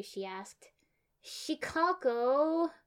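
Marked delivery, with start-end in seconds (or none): 1.2-2.7 high, loud, stretched-out whine; whining. A narrator's reading. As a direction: whine the name, dragging it out. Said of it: "My name sounded like a whine".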